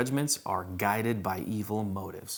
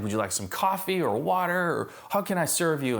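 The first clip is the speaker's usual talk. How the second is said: higher, fawning sales rep offer; super cordial; 6 semitones higher than usual. Retold or imitated. Sales rep offering refreshments to a customer, super cordial. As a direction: imitate a rep falling over himself to serve. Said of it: "super nice, super cordial".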